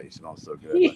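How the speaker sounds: high squeal of laughter; laughing gush. Real, not acted. Gushing over cologne.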